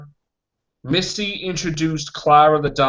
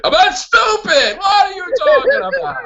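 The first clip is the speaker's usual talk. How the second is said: high, loud indignant yell; comically outraged protest; mock outrage; 14 semitones higher, 3 dB louder than usual. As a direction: shout it in hammed-up disbelief. Acting outraged reaction to lazy explanation.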